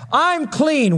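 slow, high, solemn pronouncement; Paul's declaration. Retold or imitated. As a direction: draw it out, lofty and final.

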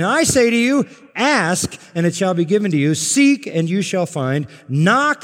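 high, loud proclaiming of ask, seek, knock; full-force preaching. Acted. Preacher proclaiming the verses at full force. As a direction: thunder the promise to the congregation.

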